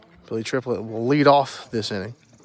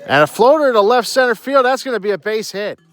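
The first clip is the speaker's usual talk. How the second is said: excited call; 7 semitones higher than usual. Real, not acted. Base hit call, rising excitement.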